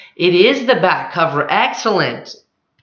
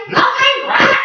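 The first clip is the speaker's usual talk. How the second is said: squeaky, much higher second Nothing?; even more outraged incredulity; rising disbelief; 3 dB louder than usual. Acted.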